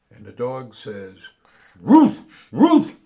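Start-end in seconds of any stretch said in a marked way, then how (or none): 1.8-3.0 very high loud bark-like Ruth; barking dog. Acted. The dog answers the bartender, barking Ruth.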